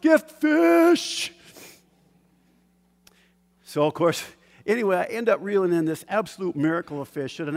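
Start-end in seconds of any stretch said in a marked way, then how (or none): 0.0-1.3 loud, high cry of disbelief; thrilled shout. Retold or imitated. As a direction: shout at a stroke of luck. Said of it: "I was like".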